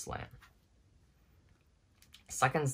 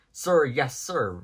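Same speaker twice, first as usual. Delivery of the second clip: louder crisp military answer; soldier's reply; 3 semitones higher, 6 dB louder, slower than usual. A narrator's reading. Sarge answering Woody, louder military reply. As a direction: snap to attention.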